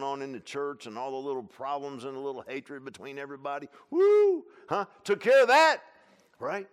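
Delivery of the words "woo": high, loud woo; preacher's whoop. Real, not acted. Preacher whooping to the congregation about the purge, loud whoop. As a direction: let out a big holler.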